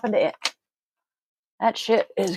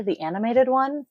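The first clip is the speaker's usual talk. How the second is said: ordinary delivery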